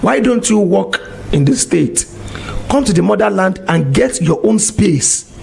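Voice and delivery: normal talking voice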